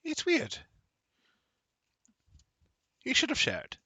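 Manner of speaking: high, lilting character voice; high-voiced woman guest; mysterious woman. GM voicing the woman, commenting.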